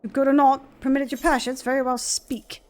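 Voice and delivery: a hint of a grave noble questioning strangers; lightly voiced stern lord. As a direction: read it like a proud lord granting an audience. Reads NPC dialogue aloud.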